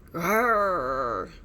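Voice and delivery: low, loud rumbling growl; a monster growl from the bush. A narrator's reading. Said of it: "the bush rattled".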